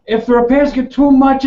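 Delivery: ordinary talk, nothing marked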